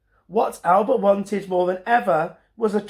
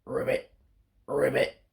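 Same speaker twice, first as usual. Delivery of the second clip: frog croak; 5 semitones lower, 5 dB quieter than usual. Acted. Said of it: "came the reply".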